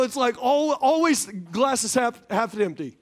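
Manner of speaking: ordinary talk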